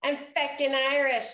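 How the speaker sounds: loud, high proud shout of the shirt slogan. Pretend. Co-host reads her shirt slogan aloud with a proud shout. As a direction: belt it out proudly. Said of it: "say it".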